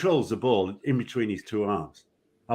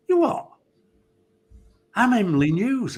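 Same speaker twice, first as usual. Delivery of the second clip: high, loud indignant yelp; outraged captain; 11 semitones higher, 4 dB louder than usual. Retold or imitated. Emlyn Hughes insisting on his name, high and loud. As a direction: squeal it in offended disbelief. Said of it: "And he goes".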